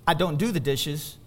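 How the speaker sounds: ordinary delivery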